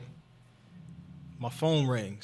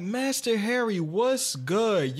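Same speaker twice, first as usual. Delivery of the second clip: raised excited greeting; hype greeting; 7 semitones higher, much slower than usual. Retold or imitated. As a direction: greet him eagerly. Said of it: "I'm like".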